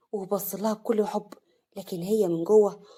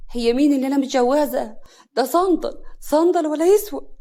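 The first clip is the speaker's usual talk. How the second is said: high, loud scoffing; scornful outburst; 6 semitones higher, 7 dB louder than usual. Acted. Wife dismissing her worthless husband, scornful outburst. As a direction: scoff louder and higher at each phrase.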